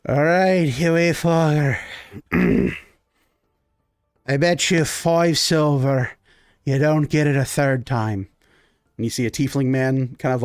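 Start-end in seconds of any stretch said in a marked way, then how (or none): 0.1-8.3 put-on slurred drunken bet across the table; rowdy drunk bettor. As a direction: dare him like a tipsy gambler. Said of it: "you hear one of them go".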